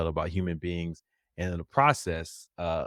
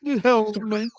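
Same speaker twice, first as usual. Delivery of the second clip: very high, loud mock Southern belle; hammed-up Southern accent, pleading; Rogue; 14 semitones higher, 7 dB louder, slower than usual. Teasing Rogue's lament to the co-host, exaggerated Southern accent. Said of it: "a Southern accent too".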